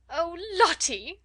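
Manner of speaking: high, loud, drawn-out cry; screamed plea. A narrator's reading. Flustered teacher to a howling child, screaming. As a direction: cry out the name in desperation. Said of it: "screamed Miss Amelia".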